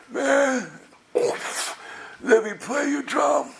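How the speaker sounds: put-on high, drawn-out drunk voice; drunk guy. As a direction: play a slow, hammed-up drunk. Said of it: "This drunk guy walks up to us".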